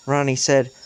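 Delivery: usual speaking voice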